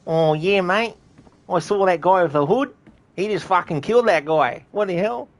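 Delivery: high, loud mock British-Australian bloke; a shocked bystander in a broad accent; British-ish NPC voice. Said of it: "that's a bit more British".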